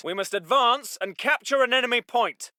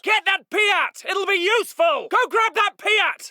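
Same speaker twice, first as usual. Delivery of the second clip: high, forceful shout; urgent shouted order; 8 semitones higher, 4 dB louder than usual. Acted. Officer sending men for the Piat, shouting. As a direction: shout to fetch the weapon.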